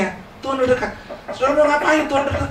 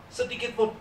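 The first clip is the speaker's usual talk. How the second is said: much softer, trailing off; fading softly; 6 semitones higher, 9 dB quieter than usual. Real, not acted. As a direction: let it sink in quietly. Repeats phrase, fading. (pelan dan melemah)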